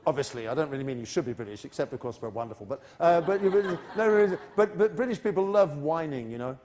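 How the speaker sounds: ordinary talk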